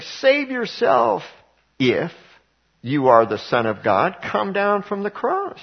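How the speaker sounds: high, slow sneering taunt; drawn-out mocking jeer, pitched high. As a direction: jeer at him from below the cross. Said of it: "and then they're mocking him".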